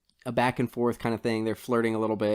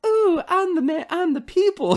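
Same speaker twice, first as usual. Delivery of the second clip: very high, loud, hammed-up impression; mock hero; 6 dB louder than usual. Podcaster imitating the disguised character turning around, high mock-hero voice. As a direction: squeal it as a silly mock boast. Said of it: "And then he's like".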